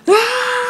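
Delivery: high scream of pain. Acted. Mario hit by Luigi, screaming in pain. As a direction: scream as the blow lands.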